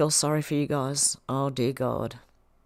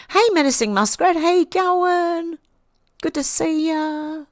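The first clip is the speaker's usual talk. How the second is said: high, drawn-out hello; calling out greeting; 9 semitones higher, 4 dB louder than usual. Real, not acted. Host welcomes a viewer into chat, high drawn-out greeting. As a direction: call out high, stretching the last words slowly.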